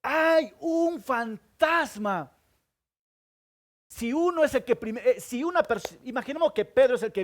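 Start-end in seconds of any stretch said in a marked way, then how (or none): 0.0-2.3 slow, high shouted alarm, much higher; a preacher's hammed-up fearful yell; loud shout. Shown as a demonstration. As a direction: bellow it like terrified fishermen. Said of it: "Estos hombres gritan fuerte".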